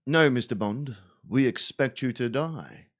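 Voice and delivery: low, measured villain voice; Bond villain. Acted. Villain sentencing captured Bond, low menacing voice. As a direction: deliver the death sentence coolly and low.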